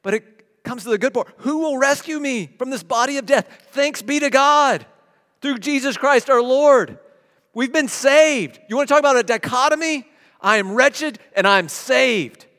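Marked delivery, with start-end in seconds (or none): 1.4-12.5 preaching at full force. Acted. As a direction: proclaim salvation to the congregation.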